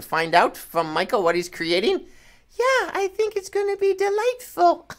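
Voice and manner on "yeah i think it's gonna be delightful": puppet voice. Pretend. Puppet Peanut answers host.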